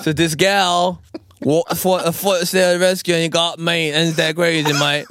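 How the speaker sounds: put-on jokey Australian accent, close to laughing. Host as kangaroo telling of the gal's rescue, amused Australian accent. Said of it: "he went back to home and he says".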